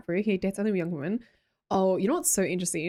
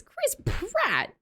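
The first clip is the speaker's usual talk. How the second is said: incredulous. Real, not acted. Incredulous echo of suggestion.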